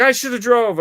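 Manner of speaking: high, loud retold panic; mock freaking out. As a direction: imitate a panicky passenger. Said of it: "she's freaking out".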